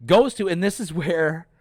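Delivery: normal talking voice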